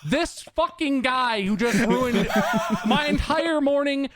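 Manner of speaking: high, exasperated rant through laughs; still livid. Real, not acted. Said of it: "'cause I'm still livid".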